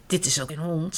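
ordinary delivery

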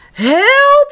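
high, loud shocked cry for help. A narrator's reading. Mother finds the bald children at the door, shocked cry. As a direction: cry out in alarm. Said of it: "riep ze".

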